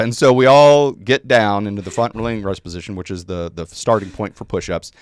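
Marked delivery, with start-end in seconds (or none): none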